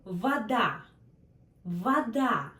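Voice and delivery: Вода said vaDA, the O said as A, stress on the last syllable; Вода as vaDA, stressed at the end; Russian вода. Shown as a demonstration. Teacher models the unstressed O for learners. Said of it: "That's why sounds like A".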